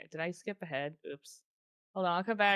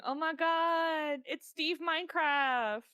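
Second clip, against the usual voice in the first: excited; 8 semitones higher, 4 dB louder, much slower than usual. Real, not acted. Excited at game reveal.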